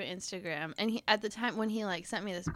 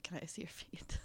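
creepy whisper; 3 semitones lower, 10 dB quieter than usual. Retold or imitated. Creepy stranger's request retold.

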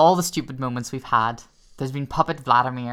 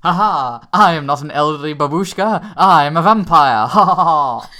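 loud, triumphant vampire reveal with laughs; gloating vampire; 3 semitones higher, 10 dB louder than usual. Retold or imitated. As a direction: throw off the disguise and gloat. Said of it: "my favorite moment was".

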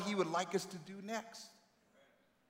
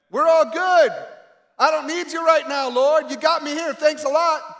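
mock dismissive; 7 semitones higher, 9 dB louder than usual. Mocks a proud successful believer.

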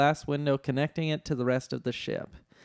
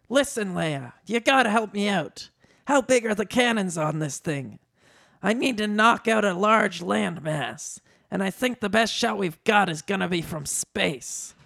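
high, urgent pleading voice; high comic character asking for help; Danny DeVito; 6 semitones higher than usual. A narrator's reading. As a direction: pitch a scheme as a little man.

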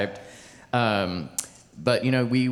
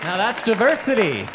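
higher, louder, amused; amused exclamation; 10 semitones higher, 5 dB louder than usual. Real, not acted. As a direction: delight in the joke. Amused response to The Swirl.

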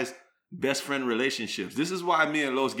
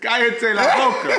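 mock shout; 10 semitones higher, 8 dB louder than usual. Mock scolding in Spanish.

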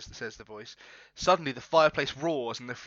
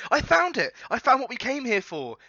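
high, loud excited cry; excited discovery; 11 semitones higher, 6 dB louder than usual. Acted. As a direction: shout it out like a thrilled treasure hunter. Friend's excited discovery shout.

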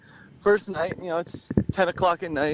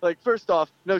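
normal talking voice

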